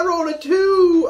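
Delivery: high, loud squeaky voice; silly put-on voice. Player whines about a bad roll, high squeaky put-on voice. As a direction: crank the pitch way up and whine it. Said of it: "I don't even know what voice this is".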